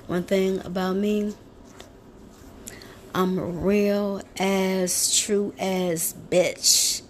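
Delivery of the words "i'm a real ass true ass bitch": slow emphatic. Real, not acted. Slow bold self-description.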